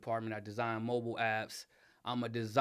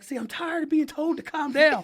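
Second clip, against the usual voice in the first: high, loud, exasperated mock yell; husband fed up with being told to calm down, mock yelling; 14 semitones higher, 8 dB louder than usual. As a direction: blow up at being told to calm down, half-playing. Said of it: "I'm like".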